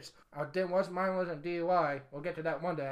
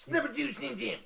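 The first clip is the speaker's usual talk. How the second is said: a half-hearted Macho Man growl; lightly voiced Macho Man; bad Macho Man impression; 6 semitones higher than usual. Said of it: "That is the worst Macho Man impression".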